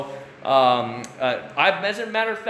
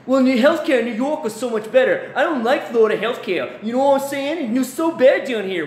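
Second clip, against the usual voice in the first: high, louder whiny complainer; mock complaining New Yorker; 9 semitones higher, 4 dB louder than usual. New Yorker complaining to his wife, high whiny mock voice. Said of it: "they'll come to my wife".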